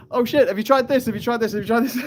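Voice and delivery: high, loud, rapid-fire retold questions; frantic troubleshooting. Acting out frantic tech troubleshooting call.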